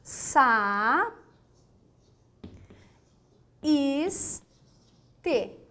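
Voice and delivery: Sa, is, te spaced with long pauses; syllable by syllable. Shown as a demonstration. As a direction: break saíste into Sa, is, te slowly. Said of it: "na palavra saíste". (separando saíste sílaba por sílaba)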